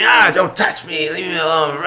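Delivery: high loud angry shout; hammed-up drunk yelling to be left alone; drunk man snapping. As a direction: yell at him like a belligerent drunk. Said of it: "He's like".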